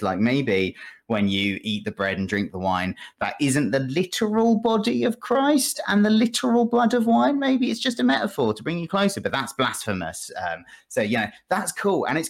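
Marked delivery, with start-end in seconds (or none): 3.2-9.2 high, quick voice of an eager heretic floating new ideas; heretical dinner guest. Retold or imitated. Dinner guest pitching Martin Luther's ideas to the monks, high and quick. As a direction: pitch it way up and rattle off the daring idea. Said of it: "the guy who's come in to dinner with you wants to chat".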